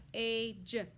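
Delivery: "P-a-j" said as three separate sounds; sounded out. Shown as a demonstration. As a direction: segment "P-a-j" for the class. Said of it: "Next word is page".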